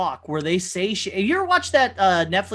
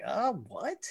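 baffled mumble; 2 semitones higher, 10 dB quieter than usual. Retold or imitated. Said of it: "And I was like".

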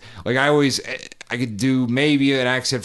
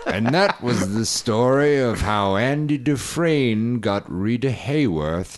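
Morgan Freeman. Acted. Guest mimicking a prison film narrator, Morgan Freeman impression. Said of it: "Morgan Freeman".

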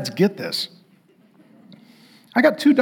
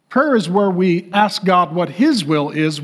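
usual speaking voice

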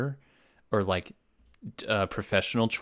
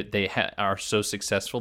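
ordinary talk, nothing marked

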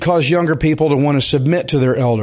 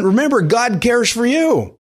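ordinary talk, nothing marked

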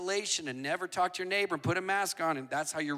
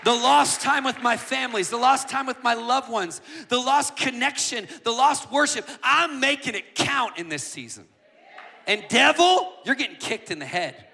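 louder and higher, driving rally cry; preacher rallying the congregation, full-force shout; full-force preaching; 6 semitones higher, 6 dB louder than usual. Acted. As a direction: build each "we want" line to a shout.

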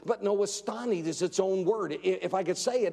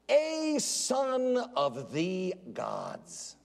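slow mock reading; much slower than usual. Mocking liberal Bible reading.

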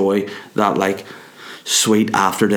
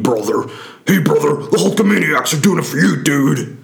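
higher, hyped wrestler promo voice; Hulk Hogan; 6 semitones higher than usual. Retold or imitated. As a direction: pump it up like a wrestling promo, brother. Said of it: "have that Hulk Hogan style".

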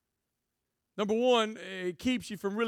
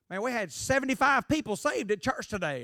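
bragging pastor buddy; 7 semitones higher, 5 dB louder than usual. Retold or imitated. Pastor buddies bragging about numbers.

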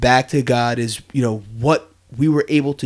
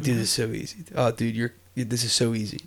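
softly talking yourself into it; quiet, casual reassurance to yourself; self-pep talk; 3 semitones lower, 6 dB quieter than usual. Retold or imitated. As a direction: play it down, low-key and soft. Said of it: "I'm telling myself it's easy".

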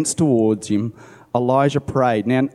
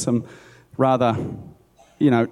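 usual speaking voice

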